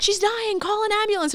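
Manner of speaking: high, alarmed call for help; panicked cleaning lady. Retold or imitated. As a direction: squeal it like a frightened cleaner.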